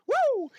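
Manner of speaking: loud high cheerful woo. Real, not acted. Streamer back with water, a loud happy woo.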